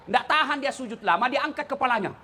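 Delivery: ordinary talk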